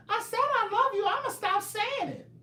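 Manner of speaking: mock a commenter in a squeaky put-on voice; high-pitched teasing impression; imitating a viewer. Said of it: "this is how she said it".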